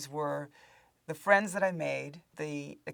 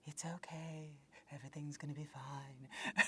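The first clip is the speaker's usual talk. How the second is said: soft hushed reassurance; soothing whisper; 3 semitones lower, 11 dB quieter than usual. Retold or imitated. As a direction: whisper comfort to crying kids. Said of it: "call back as Demetria".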